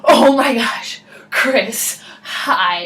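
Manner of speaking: breathy, emphatic gasped greeting; freaking out. Retold or imitated. Driver spotting camp friend at the gate, freaking out. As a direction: act out overwhelmed relief at a familiar face. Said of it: "like, freaking out".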